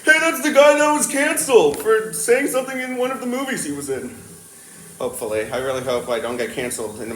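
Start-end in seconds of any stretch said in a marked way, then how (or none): 0.1-4.1 high mocking viewer voice; gossipy stranger online; future internet viewer. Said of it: "and be like".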